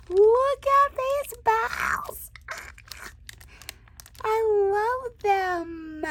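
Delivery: very high, loud, slow gush; slow squeal. Real, not acted. Crafter spotting reindeer bows, slow squeal. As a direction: draw out each word in delight.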